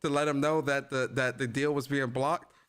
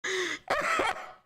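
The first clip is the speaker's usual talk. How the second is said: high laugh. Real, not acted. Laughing at Phil bit.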